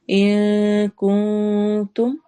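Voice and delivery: ان کنتم drawn out, the noon hidden in a long nasal hum; slowly hide the noon sound in ان کنتم. Shown as a demonstration. Teacher showing students ikhfa in ان کنتم, nasal hum. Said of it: "نون کی آواز کو چھپانا ہے".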